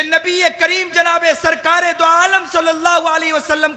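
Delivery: high, ringing sermon delivery; preacher calling out the Prophet's message to Muslims; preaching at full force. Acted. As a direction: proclaim it to the whole congregation.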